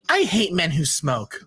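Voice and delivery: ordinary talk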